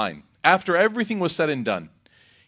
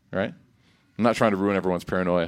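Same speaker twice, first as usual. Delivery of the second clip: ordinary talk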